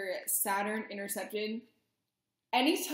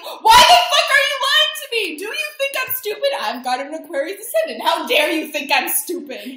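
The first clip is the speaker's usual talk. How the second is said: high, loud mock yelling; angry Scorpio confronting a liar, hammed-up shout; mock furious shout; 9 semitones higher, 14 dB louder than usual. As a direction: rant like righteous Mars caught a liar. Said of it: "so you're like".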